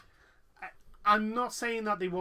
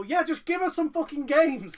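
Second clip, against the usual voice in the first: louder, higher fed-up cry for real games; hammed-up crowd demand, loud and high; exasperated mock shout; 8 semitones higher, 7 dB louder than usual. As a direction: gamers yelling at a stage show, fed up and loud. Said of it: "That we go".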